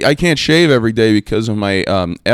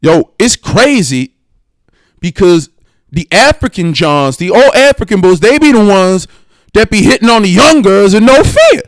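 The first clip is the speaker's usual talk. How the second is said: imitating an African coworker; 9 semitones higher, 6 dB louder than usual. Telling a story about a coworker, high loud African accent. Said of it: "this guy said to me at work".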